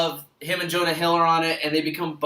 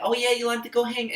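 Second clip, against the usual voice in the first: put-on higher-pitched New York accent; New Yorker accent; 8 semitones higher than usual. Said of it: "kinda like a New Yorker person".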